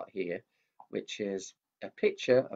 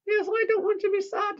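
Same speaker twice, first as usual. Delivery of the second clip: much higher squeaky puppet; 7 dB louder than usual. Acted. Mickey the puppet cutting in, high-pitched. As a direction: make the puppet pipe up.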